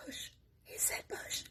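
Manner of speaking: hushed inner voice; barely audible aside to oneself; sneaky whisper. Retold or imitated. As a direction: whisper it like a naughty thought. Child's brain secretly amused by word.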